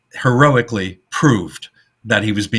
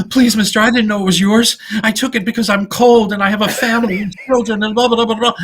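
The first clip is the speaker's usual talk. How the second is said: panicky pleading; 12 semitones higher, faster than usual. Retold or imitated. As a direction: beg like a caught thief. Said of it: "turns around panicky and says".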